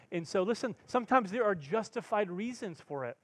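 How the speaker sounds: usual speaking voice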